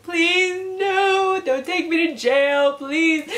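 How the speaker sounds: high, drawn-out mock wail; mock crying. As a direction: imitate yourself tearfully begging, high-pitched and whiny. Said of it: "like, literally crying".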